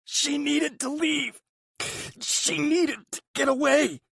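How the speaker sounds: high, urgent plea; pleading brother. A narrator's reading. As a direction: make him understand.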